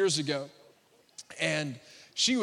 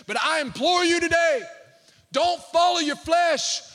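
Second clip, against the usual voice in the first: loud, high preaching voice; full-force preaching; 9 semitones higher, 6 dB louder than usual. Acted. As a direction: preach it with fire to the congregation.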